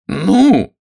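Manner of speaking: loud, high, impatient prod; sharp feminine exasperation; irritated. A narrator's reading. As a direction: demand it in annoyed high voice. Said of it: "сказала она раздражённо".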